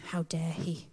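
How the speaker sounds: low, hushed disbelief; mock indignation. Reacting to Jesus asleep in the storm, hushed mock outrage. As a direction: drop right down for a dry, scandalised aside.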